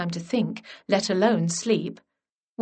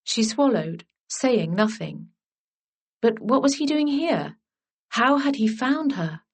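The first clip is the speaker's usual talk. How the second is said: plain reading voice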